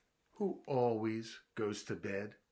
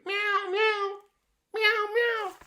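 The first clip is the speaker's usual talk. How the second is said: high, loud meowing cat; squealing kitty meows; 10 dB louder, slower than usual. Retold or imitated. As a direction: meow like a cat stuck up a tree. Two cats stuck in tree.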